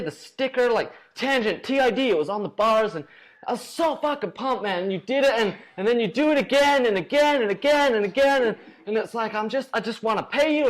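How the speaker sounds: high, excited American dude voice, pumped; impression of Rich. Drunk Rich gushing to the winner, high pumped dude voice. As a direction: gush like a drunk sponsor at an after party. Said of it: "He's like".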